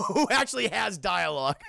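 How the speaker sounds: normal talking voice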